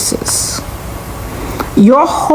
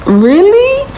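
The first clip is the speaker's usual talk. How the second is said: incredulous really; 11 semitones higher than usual. Retold or imitated. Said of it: "I'm just like".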